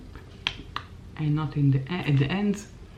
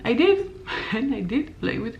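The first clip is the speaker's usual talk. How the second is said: laughing; 5 semitones higher than usual. Real, not acted. Laughs at own result.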